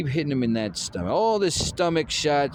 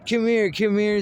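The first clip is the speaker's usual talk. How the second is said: high, louder mocking call; mock taunt; 10 semitones higher, 5 dB louder than usual. Imitating the hurt opponent, he taunts come here in a high mock voice. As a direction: call him over like a cocky taunt. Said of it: "throwing that little".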